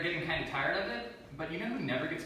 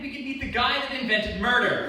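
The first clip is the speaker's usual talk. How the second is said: normal talking voice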